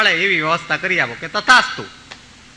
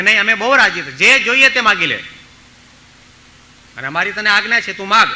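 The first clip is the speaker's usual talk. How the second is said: raised, commanding urging; loud insistence; 5 semitones higher, 7 dB louder than usual. Retold or imitated. Saints ordering the boy to ask, loudly. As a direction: push the boy hard to ask.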